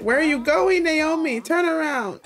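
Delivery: calling after someone. Pretend. Player calls to Naomi as she wanders off, loud and exasperated. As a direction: call the runaway back, playfully bossy.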